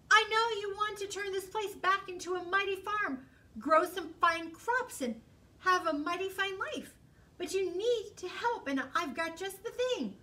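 high salesman fox voice; high, eager fox; sly fox. Acted. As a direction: pitch it high like a fast-talking fox. Fox voice pitch.

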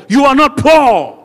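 high, slow, loud sermon cry; full-force preaching. Real, not acted. Preacher ending a list of hardships, full-force preaching. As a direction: shout it out as the climax, stretched.

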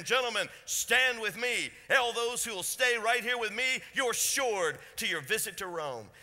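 loud, high call to stand firm; rally the frightened men with a ringing call. Acted. Paul calls to the men in the storm, loud and rousing.

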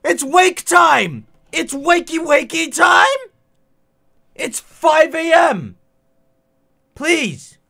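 high, loud, slow desperate yell; shouting in disbelief at the hour; frantic shouting. Real, not acted. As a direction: beg at the top of your lungs. Yelling at 5 AM.

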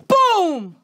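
high, loud shout falling away; explosive boom. Retold or imitated. Telling how she hit the woman, explosive boom. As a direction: yell it like the punch landing. Acting out the punch she threw.

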